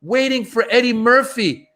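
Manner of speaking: high mock-disappointed complaint; retold stage lament. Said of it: "I go".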